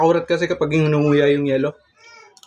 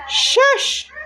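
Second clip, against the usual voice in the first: excited squeal. Acted. Hype exclamation after the reveal.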